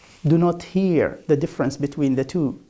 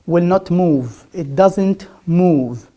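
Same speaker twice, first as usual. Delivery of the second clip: ordinary talk, nothing marked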